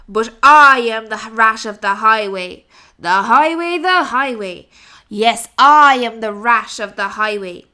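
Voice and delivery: booming, demanding highway robber rat; greedy rat roaring at the rabbit for cakes; loud bullying rat. Acted. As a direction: bellow it like a greedy bandit. Rat threatens to eat duck.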